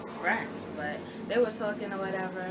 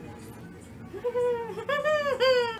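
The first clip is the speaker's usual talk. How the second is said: loud, high burst of laughter; high-pitched laugh; 13 semitones higher, 7 dB louder than usual. Acted. Storyteller reacting to "mind your business", loud high laugh.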